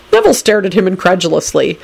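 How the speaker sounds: plain reading voice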